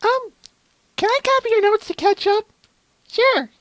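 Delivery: bright eager student voice; Twilight voice. Acted. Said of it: "So we have Twilight".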